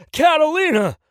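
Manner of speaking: high, loud alarmed yell; yelling a name. Acted. Tony yells at Catalina holding the gun, full yell. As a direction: scream her name to stop her. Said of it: "Tony yelled at her".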